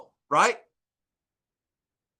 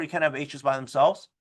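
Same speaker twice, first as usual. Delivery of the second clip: ordinary talk, nothing marked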